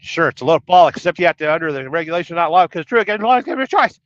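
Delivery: loud, fast garbled reading; rushed reading. Real, not acted. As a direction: rattle it off quickly. Reading garbled chat comment fast.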